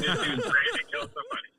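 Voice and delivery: high, laughing delivery; cracking up while telling the story. Real, not acted. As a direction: tell it through real laughter. Amused telling of a fight story.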